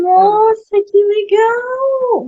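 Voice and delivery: real delight, high and drawn out; delighted surprise. Real, not acted. Guest learning host was born in Bahia, delighted surprise. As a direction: stretch it out high in happy amazement. Reacts to his Bahian birthplace.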